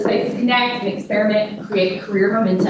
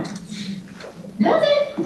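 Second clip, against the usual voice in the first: high, breathy disbelieving whisper; a quick incredulous whisper to yourself; doubtful aside; 4 dB quieter than usual. Retold or imitated. Skeptical aside to herself.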